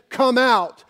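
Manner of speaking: booming come out; commanding call. Retold or imitated. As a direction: call the whole cemetery out. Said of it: "just said".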